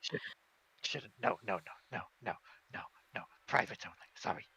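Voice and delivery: refusing tabaxi. Acted. Shopkeeper refuses to sell his private stock, softly nervous.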